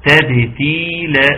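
slow تبتيلا with a long, clear ي after the second ت; clear kasra. Shown as a demonstration. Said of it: "كسر التاء الثانية". (تحقيق كسر التاء الثانية في «تبتيلا» ببطء)

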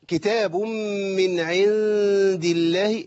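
slow recitation, الله said "illaahi" with a light lam. Shown as a demonstration. Teacher modelling the light lam, slow chanted recitation. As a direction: chant كتاب من عند الله with a light, thin L in الله. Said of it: "مرققة".

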